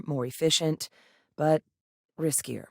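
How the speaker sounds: ordinary talk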